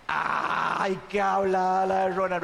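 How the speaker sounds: voz ligeramente cambiada de oyente escéptico, "Ay" más grave; oyente burlón. Retold or imitated. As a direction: imita con burla suave al público incrédulo. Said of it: "aquí es donde ustedes van a decir". (el orador imita al público que duda de él, con burla ligera)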